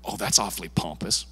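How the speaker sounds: low, soft, disapproving sniff; sniffy scoff. Retold or imitated. Pastor voicing a critic of his bold prayer, low and sniffy.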